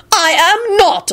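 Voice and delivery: high, drawn-out denial; slow, high-pitched outrage; outraged. A narrator's reading. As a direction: deny it with indignation. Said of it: "in an outraged voice".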